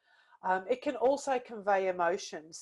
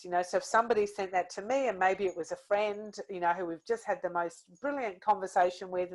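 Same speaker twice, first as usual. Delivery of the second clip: ordinary delivery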